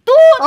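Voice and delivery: loud high toot like a pipe; horn toot. Pretend. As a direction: blast a horn sound into her ear. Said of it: "هزمر في ودنك".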